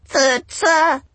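high, loud croak; croaking bird. A narrator's reading. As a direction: croak it like a seabird. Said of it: "croaked the angel".

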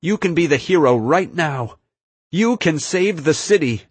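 urgent plea. A narrator's reading. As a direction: plead earnestly to win them over. Narrator's hero pleads with villain.

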